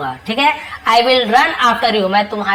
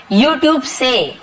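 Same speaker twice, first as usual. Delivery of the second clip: YouTube से stretched very slowly; slow emphasis; drawn-out word; 3 semitones higher, much slower than usual. Shown as a demonstration. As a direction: linger on YouTube से. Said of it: "slow repeat of source word".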